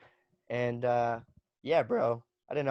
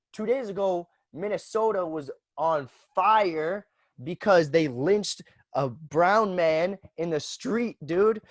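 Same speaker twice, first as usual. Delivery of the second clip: outraged; 6 semitones higher, 4 dB louder, slower than usual. Real, not acted. Outraged about lynching.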